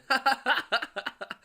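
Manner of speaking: high real laughter burst; laughing. Real, not acted. Amused at the movie, a real high laugh. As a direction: crack up for real. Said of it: "she starts laughing".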